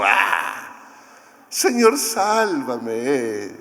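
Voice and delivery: a limp, casual sigh of a plea, no urgency; half-hearted plea. Retold or imitated. Mock contrast of a Pedro who is not really sinking, half-hearted plea. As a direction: ask to be saved as if it barely matters. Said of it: "Ya no era el Pedro así como".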